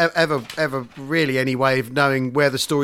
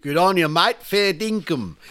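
mock Aussie; 2 semitones higher, slower than usual. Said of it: "Aussie accent".

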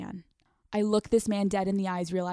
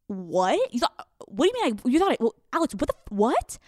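sputtering, higher-pitched false starts; imitated bewilderment; 4 semitones higher, faster than usual. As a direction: trip over the questions in mock disbelief. Imitating his stammering bewilderment.

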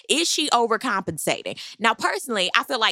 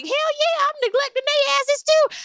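high-pitched, hammed-up bragging confession; brazen admission; 3 dB louder than usual. Recapper translates Robin's "well, yeah", high and brazen. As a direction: exaggerate it into a shameless squealed yes. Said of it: "it was like".

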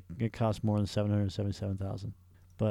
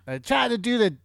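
high-pitched failed attempt at a celebrity voice; botched Joe Pesci; 9 semitones higher than usual. Host attempting voices for co-host, botched impression. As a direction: push it up high, a bad impression. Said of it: "I can't do fucking Joe Pesci".

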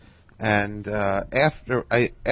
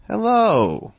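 imitating Carl Reiner; 11 semitones higher, 5 dB louder than usual. Carl answers the phone.

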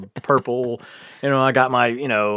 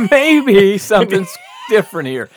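high laughing yell, half disbelief; laughing loud at his own story; laughing shout; 7 semitones higher, 7 dB louder than usual. Real, not acted.

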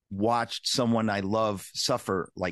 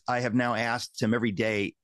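ordinary talk, nothing marked

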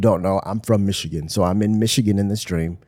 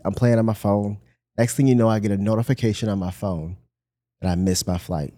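ordinary delivery

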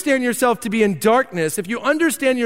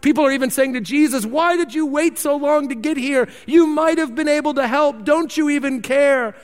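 higher, anxious, pleading voices; freaking-out mourners; 7 semitones higher than usual. Retold or imitated. Mourners at Lazarus' funeral question Jesus, higher and distressed. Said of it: "everyone's freaking out".

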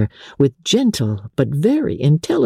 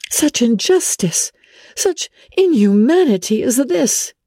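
put-on higher young woman's voice, shocked and indignant; Elizabeth, indignant; 8 semitones higher than usual. A narrator's reading. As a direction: rise up in disbelief at the injustice.

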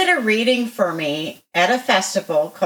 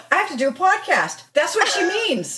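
excited; 9 semitones higher than usual. Retold or imitated. The sudden idea.

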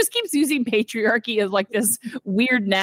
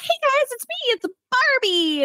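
much higher, bubbly doll voice; chirpy Barbie voice. Retold or imitated. Barbie greeting the angsty tween, high bubbly doll voice. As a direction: put on a sweet doll greeting, pitched way up.

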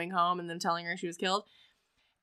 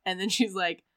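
ordinary talk

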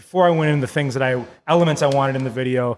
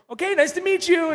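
mock party girl. The new bride asking about stages, high and airy. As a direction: ask it sweetly, not a care in the world.

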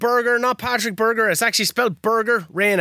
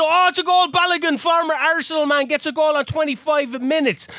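high, urgent football commentary; excited goal call; 7 semitones higher than usual. Real, not acted. Player commentates his match's goal, excited call. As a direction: announce the goal in a burst of excitement.

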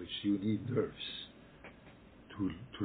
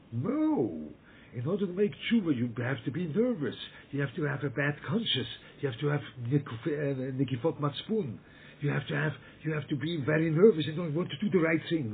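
loud, higher, worked-up insistence; a put-on nervous outburst, louder and higher; mock wrong view; 6 semitones higher, 5 dB louder than usual. Shown as a demonstration. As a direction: hammer out the mistaken idea as if frantic. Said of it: "That's all wrong".

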